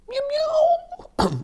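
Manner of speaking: high squeaky meowing; cat meow. Retold or imitated. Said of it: "Il a fait".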